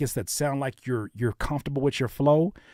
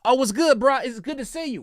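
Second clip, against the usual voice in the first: yelling conversation; 13 semitones higher than usual. Shown as a demonstration. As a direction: greet a friend at full yell. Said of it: "we don't talk like this to each other".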